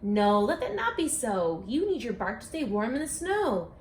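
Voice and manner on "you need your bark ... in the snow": ordinary delivery, nothing marked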